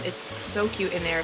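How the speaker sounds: ordinary talk, nothing marked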